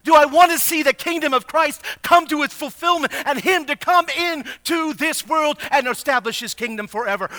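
high, loud, impassioned preacher; full-force preaching. Acted. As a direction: preach it hard, pressing each question on the listeners.

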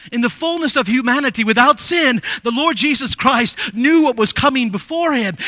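normal talking voice

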